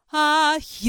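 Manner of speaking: loud sustained ah with the mouth open. Shown as a demonstration. Voice teacher showing an opened vowel on a held note. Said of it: "開くみたいな".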